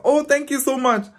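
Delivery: high, sweet, polite thank-you again; a sugary high thank-you to a stranger. Retold or imitated. Polite reply repeated.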